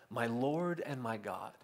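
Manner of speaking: quiet, slow and hushed; a hushed, reverent "My Lord and my God"; reverent quote. Retold or imitated. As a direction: breathe it out like a confession of awe. Thomas's awed confession, quoted.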